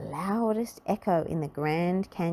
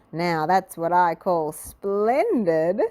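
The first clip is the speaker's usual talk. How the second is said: slow, loud grand exclamation; proud lady exclaiming over her stone face; 5 dB louder, slower than usual. A narrator's reading. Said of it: "exclaimed Little Miss Splendid".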